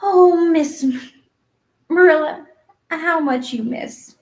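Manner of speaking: high, dramatic lament; theatrical young girl aghast; amazed pity. A narrator's reading. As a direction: exclaim loudly, then slow down with feeling. Anne pities Marilla's lack of imagination.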